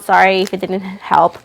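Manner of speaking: ordinary delivery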